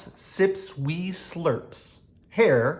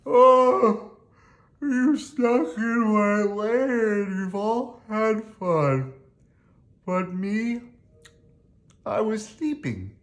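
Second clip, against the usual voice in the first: much higher, whiny, self-pitying bear; whimpering bear; 8 semitones higher than usual. Acted. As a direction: sulk and whine like a grumpy woken bear. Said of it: "And he whimpers, and he moans".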